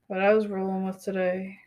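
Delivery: ordinary delivery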